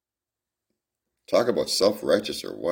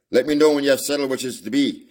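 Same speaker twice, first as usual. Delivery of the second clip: loud, higher mock politeness; sarcastic; 7 semitones higher, 6 dB louder than usual. A narrator's reading. Farmer sneers at leaving suitors, sarcastic and loud. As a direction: sneer it as you see them off. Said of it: "he said sarcastically".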